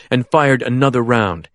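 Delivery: usual speaking voice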